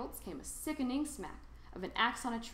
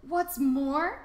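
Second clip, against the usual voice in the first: higher, louder sharp retort; snapping Lorax; 6 semitones higher, 9 dB louder than usual. A narrator's reading. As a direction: bite the words off in irritation. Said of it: "snapped the Lorax".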